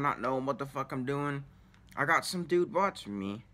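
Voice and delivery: ordinary talk, nothing marked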